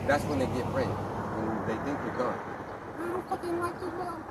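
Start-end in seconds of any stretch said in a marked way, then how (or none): 2.4-4.2 high, slow whiny kid imitation; mock kid voice. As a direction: whine it like a sulky kid in a caricature. Retelling a family fight.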